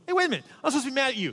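put-on high, loud kid remembering his grudge; suddenly mad little boy. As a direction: imitate a child's abrupt indignation. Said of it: "he'd forgotten that he was mad".

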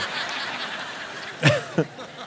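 silent wheezing laugh; breathless laugh. Real, not acted. Pastor cracking up at his own story, wheezing laughter. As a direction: laugh helplessly without voice.

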